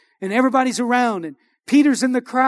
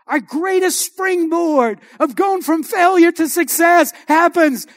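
higher, louder, rousing pulpit delivery; full-force preaching; 4 semitones higher, 6 dB louder than usual. Real, not acted. Preacher urging the struggling to be encouraged, impassioned preaching. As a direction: drive the encouragement home to the congregation, loudly.

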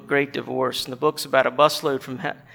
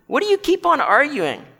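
much higher, indignant question; exasperated ghost; 10 semitones higher, 3 dB louder than usual. Acted. Ghost objects to the bright man's replies, exasperated. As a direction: throw the question back, high and exasperated. Ghost snaps back in acted dialogue.

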